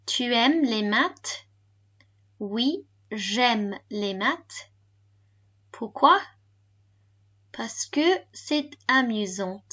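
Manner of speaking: slow, drawn-out model French. Shown as a demonstration. Teacher repeats the recorded dialogue for learners, slowly. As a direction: say each phrase slowly and separately. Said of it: "And slower".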